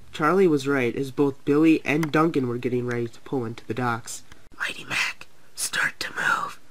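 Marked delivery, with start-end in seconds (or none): 4.6-6.6 soft, voiceless whisper. A narrator's reading. Charlie cues Mighty Mac in the ambush, whispering. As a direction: whisper the cue stealthily. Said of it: "whispered Charlie".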